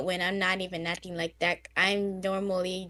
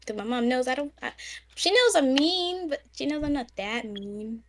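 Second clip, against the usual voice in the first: normal talking voice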